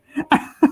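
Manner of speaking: high helpless giggle. Real, not acted. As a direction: burst out giggling. I can't stop laughing.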